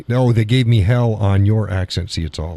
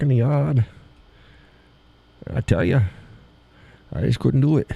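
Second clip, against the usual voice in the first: put-on New England accent, often pitched up high; New Hampshire accent; 4 semitones higher than usual. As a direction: do an r-less New Hampshire local.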